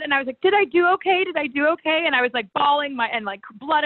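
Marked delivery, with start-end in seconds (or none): 0.4-1.2 a pleading high question; anxious retold plea. Retold bloody match, bawling. 1.2-2.0 repeated anxious plea. Retold or imitated. Retold bloody match, bawling.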